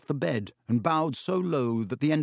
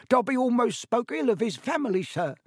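high, squeaky little servant; Dobby, elf voice; 11 semitones higher than usual. A narrator's reading. Elf excuses punishing himself, high-pitched.

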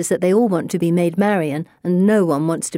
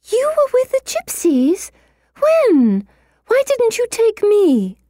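put-on very high, outraged girlish squealing; indignant squawk; 4 dB louder than usual. A narrator's reading. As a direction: squawk in disbelief at being left out. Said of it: "Pippa's squawking about the way".

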